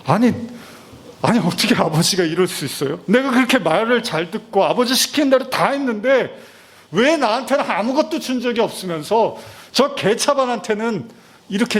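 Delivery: a son's bitter, high-pitched grievance; indignant complaint. Acted. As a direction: pitch rising high in hurt protest. Preacher voices the angry elder brother.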